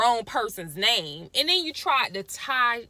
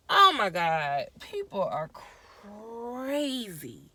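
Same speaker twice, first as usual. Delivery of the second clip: real exasperation; much slower than usual. Real, not acted. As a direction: drag out "crazy" in disbelief. Exasperated after the scolding.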